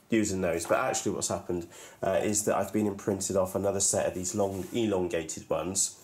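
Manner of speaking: ordinary talk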